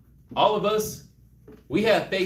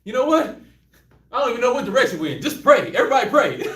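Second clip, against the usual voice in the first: loud, laughing mock panic; hammed-up frenzy about which way to pray; frantic praying; 2 semitones higher, 6 dB louder, faster than usual. Disciples in the storm, frantic and loud. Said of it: "Everybody's like".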